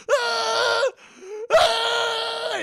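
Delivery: high, loud wail of pain; two long mock screams of agony; imitated agonized scream. Storyteller voicing old man after his fall, agonized screaming. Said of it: "in horrible fucking pain".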